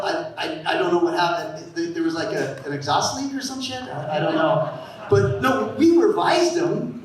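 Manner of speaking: ordinary talk, nothing marked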